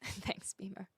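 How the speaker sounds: whispered thanks; soft whispered thank-you. Real, not acted. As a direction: breathe the thanks unvoiced.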